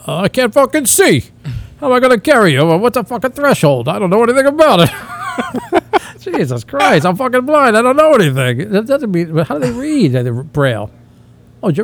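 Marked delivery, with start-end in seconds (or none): 0.1-8.0 higher, louder mock complaint, breaking into a laugh; exasperated blind groom. The blind groom protesting to his bride, exasperated and laughing. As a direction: whine it like a flustered blind husband.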